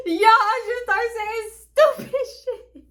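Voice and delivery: cracking up as she tells it; laughing. Real, not acted. Telling her listeners the story, laughing hard.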